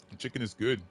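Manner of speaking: mouth full. Real, not acted. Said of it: "sorry about the crunch".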